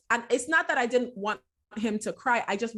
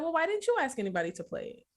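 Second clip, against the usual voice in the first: mom's gentle question; 7 semitones higher than usual. Retold or imitated. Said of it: "naturally, I was like".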